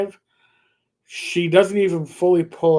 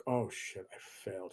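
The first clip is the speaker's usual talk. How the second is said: much softer, muttered to himself; quiet dismay; 9 semitones lower, 17 dB quieter than usual. Retold or imitated. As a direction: sink into a soft muttered oh no. Inner panic at road test.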